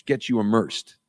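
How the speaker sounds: normal talking voice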